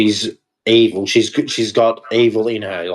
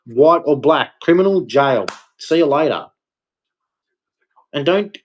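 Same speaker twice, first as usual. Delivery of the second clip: normal talking voice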